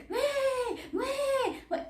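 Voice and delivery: high, soft mocking whimper; mock whining cry. Vlogger imitates Africa complaining with a mocking whimper. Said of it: "you never hear Africa say".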